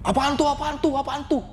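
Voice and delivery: imitating a friend's scared shout; retold panicked yell. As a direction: shriek it like you just saw something in the dark. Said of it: "Sampai teriak".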